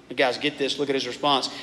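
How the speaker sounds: ordinary talk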